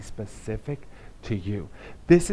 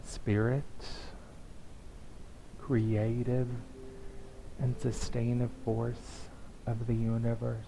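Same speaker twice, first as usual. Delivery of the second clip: soft prayer; 3 semitones lower, 8 dB quieter than usual. Acted. Pastor leads the congregation in soft prayer. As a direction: pray gently over the room.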